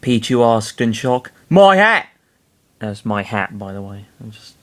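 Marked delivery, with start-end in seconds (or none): 1.5-2.1 loud, high Cockney cry; Cockney yell. A narrator's reading. Ness watching the hat burn, Cockney yell. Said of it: "Ness yelled".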